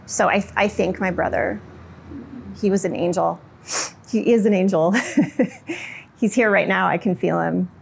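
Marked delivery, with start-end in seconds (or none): 5.0-6.0 high little burst of laughter; real light laugh. Real, not acted. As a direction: let a short laugh escape. Tender laugh after calling brother angel.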